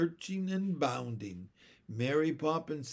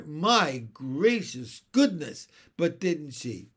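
half amused, half accusing; 4 semitones higher, 5 dB louder than usual. A narrator's reading. Uncle teasing Mary over the funny old body, half amused, half accusing. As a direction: scold with amusement under it. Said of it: "half amused, half accusing".

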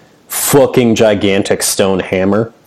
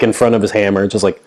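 normal talking voice

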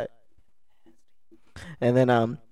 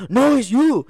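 defensive; 12 semitones higher, 8 dB louder, slower than usual. Retold or imitated. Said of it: "then he gets defensive".

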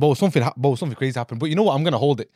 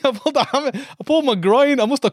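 high, louder, tumbling out with laughter; animated confession; 8 semitones higher, 4 dB louder than usual. Real, not acted. Friend confessing he pulled his groin, high and animated. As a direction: blurt the injury out, much higher pitched.

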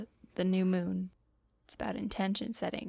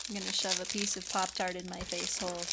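ordinary talk

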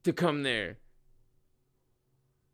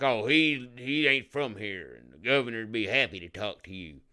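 mocking southern accent; 4 semitones lower than usual. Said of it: "I don't like mocking the southern accent".

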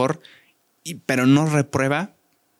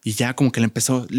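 ordinary talk, nothing marked

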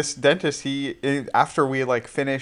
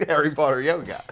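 laughing; 3 semitones higher than usual. Retold or imitated. Acting out the dentist's pitch.

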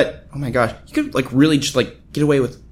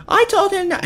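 indignant mom. Retold or imitated. Mom voiced in argument.

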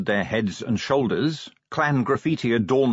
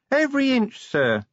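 distant tube voice. Acted. Littlebottom on tube.

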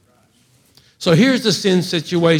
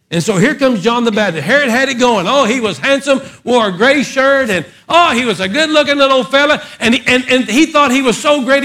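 high, loud revival preaching; fiery preacher climbing higher; preaching at full force; 9 semitones higher, 6 dB louder than usual. Acted. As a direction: whip the congregation up, loud and high.

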